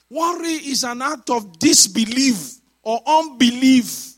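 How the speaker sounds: high, loud pulpit declaration; full-force preaching. Acted. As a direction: drive the point home to the congregation.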